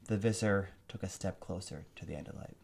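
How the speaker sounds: usual speaking voice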